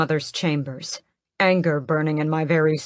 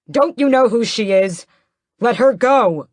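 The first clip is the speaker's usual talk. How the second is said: loud, higher demand to release her; angry shout; 6 semitones higher, 7 dB louder than usual. Acted. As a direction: yell it over the crowd. Raoul fighting through the guards.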